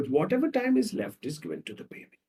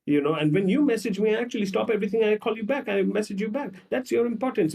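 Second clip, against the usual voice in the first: high, complaining rant; mock angry girlfriend; 6 semitones higher than usual. Girlfriend accusing boyfriend of ignoring her messages, high and scolding. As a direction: demand why he ignored her. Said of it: "She's like".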